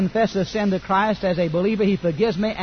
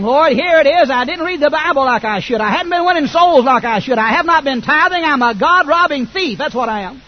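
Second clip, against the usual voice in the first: loud, raised pleading confession to the Lord; loud confession prayer; 6 semitones higher, 7 dB louder than usual. Acted. Preacher loudly confessing sins to the Lord. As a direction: pour it out loud to God.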